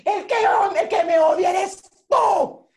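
hammed-up angry shout, high and loud; arrecha. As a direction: yell the accusation, dragging the last word. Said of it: "me paro yo arrecha". (grito de rabia exagerado)

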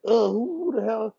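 startled annoyance. Retold or imitated. Fighter hit in the ribs mid-workout, startled. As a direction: act out being caught off guard. Retells reacting to being cracked in ribs.

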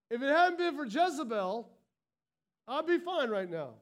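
whiny victim. Pretend. Preacher mocking a victim blaming Jezebel, loud whine. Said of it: "if you're called a Jezebel".

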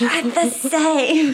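giggling. Acted. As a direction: giggle through the words. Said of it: "Oriel's gonna giggle".

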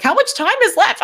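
high, urgent question; anxious viewer checking the clock; frantic impatience. Retold or imitated. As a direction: demand it, voice jumping up. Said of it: "'Cause I was like".

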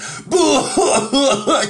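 high, hacking mock cough-laugh; imitated coughing fit. Old man choking on a toke, mock coughing fit. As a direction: act out choking on the smoke. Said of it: "just coughing".

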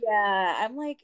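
ordinary talk

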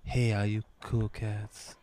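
sultry late-night DJ voice; radio DJ voice. Retold or imitated. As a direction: imitate a smooth seventies DJ. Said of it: "her voice is like".

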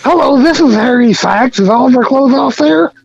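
lightly put-on prank-call voice; mild silly caller voice. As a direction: fake caller, innocent tone.

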